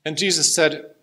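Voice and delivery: ordinary delivery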